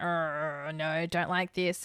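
low, quiet grumble; soft, low voice for a wary animal; grumbling dog voice. Retold or imitated. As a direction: mumble it like an uneasy dog. Voices dogs sniffing at the basket.

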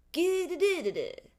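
loud high nonsense jabber; loud mock babble. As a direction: blurt out gibberish sounds. Mock noise after rant.

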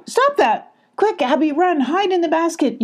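high urgent call, mild volume; mock yell. Molly warns Abby to hide, light mock yell. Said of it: "yelled Molly".

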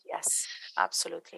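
a soft whispered yes. Real, not acted. Host agreeing with co-host, whispered. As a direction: breathe it under the talk.

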